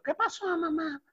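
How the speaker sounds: put-on high, soft little boy; child's voice. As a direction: answer mom like a small kid. Child Jaimito answers. (voz de niño aguda y suave)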